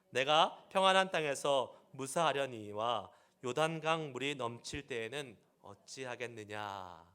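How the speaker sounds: loud, measured Bible reading; scripture reading. Acted. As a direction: read God's words out with weight. Reads scripture aloud.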